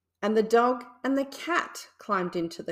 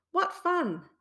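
delighted pig; 9 semitones higher than usual. A narrator's reading. Pig enjoying the slide.

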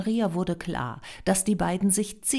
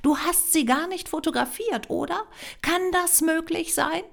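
high-pitched astonished questions; pitched way up, amazed; incredulous questioning; 12 semitones higher than usual. A narrator's reading. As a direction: question him in rising disbelief. Greta confronts Noah in disbelief.

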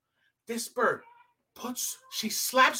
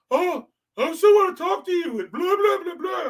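mocking whiny imitation; 4 semitones higher, 3 dB louder than usual. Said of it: "the trout society".

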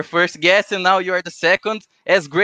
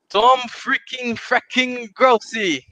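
announcer intro; 5 semitones higher, slower than usual. Acted. Host introduces the guest.

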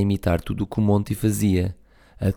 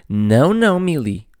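hurried; 6 semitones higher, 5 dB louder than usual. Acted. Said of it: "apressou-se a dizer".

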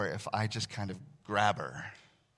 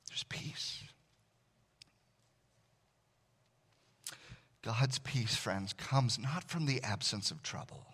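hushed pastoral voice; 7 dB quieter than usual. Acted.

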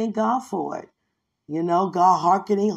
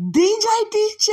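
high, loud calling of his name; excited squeal; 13 semitones higher, 3 dB louder than usual. Retold or imitated. Friend sees picture again.

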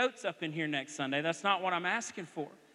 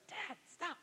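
soft, higher embarrassed plea; hushed plea; 9 semitones higher, 11 dB quieter than usual. Retold or imitated. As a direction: quietly beg Dad to stop. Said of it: "He'd go".

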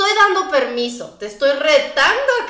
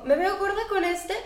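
higher, soft mock of an anxious question; mock insecure woman; 7 semitones higher, 4 dB quieter than usual. A wife asking her husband about her weight, lightly mocked. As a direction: ask it like she fears the answer. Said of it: "Es así como".

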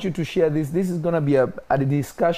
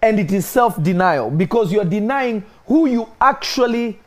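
high, loud, rising sermon; fiery preaching; 4 semitones higher, 3 dB louder than usual. Acted. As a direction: preach it with mounting fire. Preaching builds to full force.

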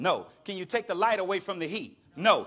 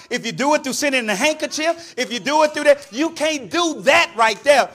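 loud, raised preaching voice; forceful preaching; 6 semitones higher, 11 dB louder than usual. Real, not acted. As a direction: drive the point home from the pulpit. Preaching at high energy.

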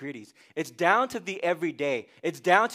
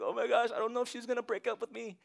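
quick, soft fretting; hammed-up worried single; mock anxious panic; 5 semitones higher, 7 dB quieter, faster than usual. Pastor mimics anxious single in his office, quick soft fret. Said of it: "coming to my office like".

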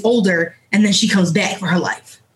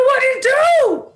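much higher, squeaky hype call; high-pitched greeting; 14 semitones higher, slower than usual. Acted. Intro catchphrase, escalating.

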